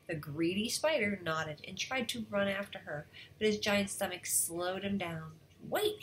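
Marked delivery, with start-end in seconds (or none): 5.7-6.0 high storybook call to wait; crying out. A narrator's reading. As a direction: call after someone in a raised pitch. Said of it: "he cried".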